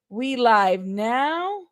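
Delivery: very slow, drawn-out words; going-live announcement. Real, not acted. Streamer tells the people we live, drawn out slowly. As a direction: stretch out each word of the announcement.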